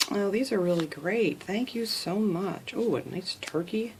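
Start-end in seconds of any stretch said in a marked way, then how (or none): none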